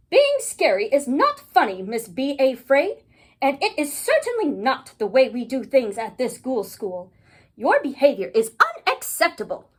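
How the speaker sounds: loud, emphatic telling-off; scolding principal. Acted. Ghoul school principal telling off Miss B A Fraid, loud and stern. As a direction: scold a pupil, hitting the key words hard.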